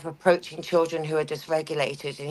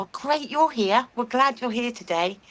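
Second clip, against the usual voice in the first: higher, bright "Oh, great" with stress on "you're", then "glad" lifted; delighted welcome; 5 semitones higher than usual. Retold or imitated. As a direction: greet the child as if you've been waiting for them all day. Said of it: "And they feel loved".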